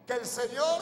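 booming call to the congregation; loud. Shown as a demonstration. Pastor urging church to proclaim, loud. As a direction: say it strong, lead them. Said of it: "diga bien fuerte".

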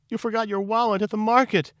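very high, loud alarmed cry; almost yell it in a high, put-on voice; near yell. A narrator's reading. Neurosis alerts Lily Bunny about his wallet, nearly yelling. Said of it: "nearly yelled".

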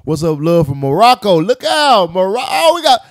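loud excited shoutout. Real, not acted. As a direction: call out the greeting big.

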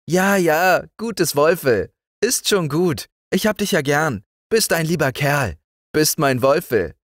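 put-on high, bright child voice; cheerful young girl talking, pitched well up; little girl Liesel. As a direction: speak up like a fearless little girl. Liesel pets the dog.

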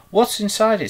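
high, loud, eager question; excitedly curious, pitched right up; wriggling curiosity. A narrator's reading. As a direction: ask it bursting with curiosity. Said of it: "wriggling with curiosity".

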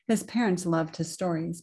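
ordinary delivery, nothing marked

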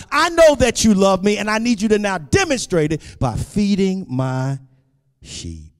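high, loud, driving pulpit cry; fervent sermon climax in short, punched phrases; impassioned preaching. Acted. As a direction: build to full force, voicing the Lord's reassurance.

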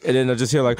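ordinary delivery

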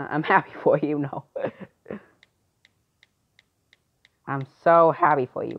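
ordinary talk, nothing marked

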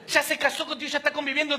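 ordinary delivery

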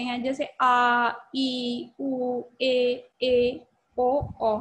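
each long vowel held slowly and fully; long Hindi vowels sung out slowly; drawn-out long vowels. Shown as a demonstration. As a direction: stretch every vowel sound long. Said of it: "तो जैसे आपने सुना".

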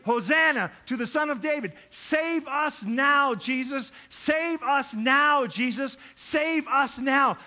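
swelling, insistent acclamation; building crowd cry. Acted. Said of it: "they start with one voice saying".

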